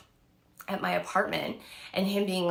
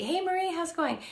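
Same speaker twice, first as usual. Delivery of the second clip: high sing-song hello; bright greeting; 10 semitones higher than usual. Real, not acted. Greeting Marie mid-story, high sing-song hello. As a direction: call out a cheery hi.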